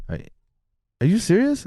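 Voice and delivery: doctor amazed at his test numbers, high and incredulous; stunned doctor. Retold or imitated.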